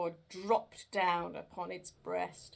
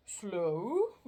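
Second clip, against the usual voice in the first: ghost voice; 7 dB louder than usual. Acted. Ghost repeats Scrooge's word.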